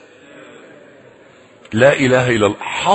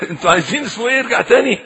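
ordinary delivery

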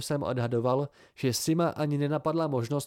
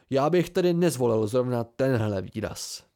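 usual speaking voice

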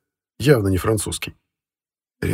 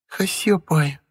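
put-on purring toddler; 8 semitones higher, much slower than usual. A narrator's reading. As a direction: purr it like a cuddly toddler. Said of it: "мурлыкнула дочь".

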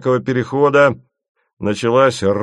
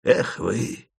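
softer vexed sigh; with vexation; 4 dB quieter than usual. A narrator's reading. Asen, vexed, sighs at the travellers. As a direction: let the disappointment out. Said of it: "с досадой произнёс Асен".

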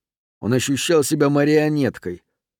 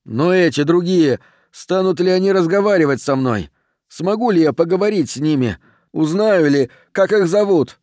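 raised, louder voice, higher pitch; calling after someone; 6 semitones higher, 4 dB louder than usual. A narrator's reading. Rent shouts questions after the departing milkman, calling out. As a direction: call urgent questions after a departing figure. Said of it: "он крикнул вслед молочнику".

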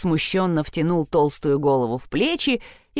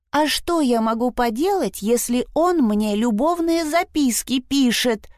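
high-pitched, indignant outburst; exclaimed protest; 6 semitones higher than usual. A narrator's reading. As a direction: protest as if unfairly blamed. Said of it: "воскликнула Фрида". (возмущённое восклицание высоким голосом)

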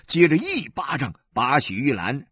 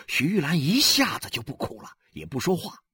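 ordinary delivery, nothing marked

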